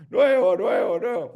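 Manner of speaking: nuevo, nuevo, nuevo chanted high like a cheap ad; hammed-up repeated sales cry; mock ad slogan. Mocking brands by repeating their slogan: hammed-up sales chant.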